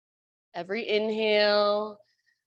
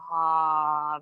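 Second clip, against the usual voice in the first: dramatic sigh; 4 semitones lower than usual. Shown as a demonstration. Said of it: "And open your mouth, let it go".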